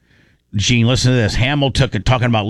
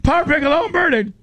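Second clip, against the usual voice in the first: high squealing repeated plea; hammed-up frantic cry; mock panic; 13 semitones higher, faster than usual. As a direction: squeal it twice, desperate. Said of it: "Lummy was like".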